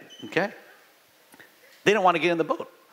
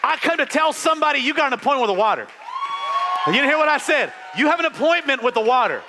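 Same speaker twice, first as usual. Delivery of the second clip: preaching at full force; 10 semitones higher than usual. Acted. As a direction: drive it home to the congregation, almost shouting.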